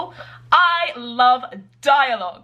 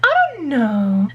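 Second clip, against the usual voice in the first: higher, drawn-out sarcastic hum; 8 semitones higher, slower than usual. Acted. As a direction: play it dry and sarcastic. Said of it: "sarcastic characters".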